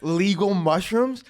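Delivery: high-pitched, worked-up mock indignation; defiant justification. Comic justifies overdoing mushrooms in Amsterdam, indignant. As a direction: argue your case with pitch jumping up, then easing. Acting out his defiant reasoning.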